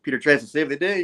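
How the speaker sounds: usual speaking voice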